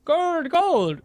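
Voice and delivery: high, hissing gold gold with Irish lilt; Irish Gollum. Pretend. Streamer tries gold instead of Gollum, high and lilting. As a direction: squeak it like a greedy creature with a brogue. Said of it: "He says gold instead of Gollum".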